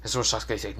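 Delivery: usual speaking voice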